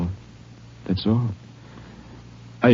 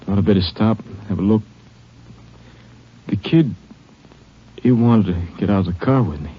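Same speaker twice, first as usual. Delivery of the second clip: broken account with long pauses between phrases; wounded, halting; 4 semitones higher than usual. Acted. Wounded deputy explaining about his kid to the Ranger, halting. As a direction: struggle through it, stopping often.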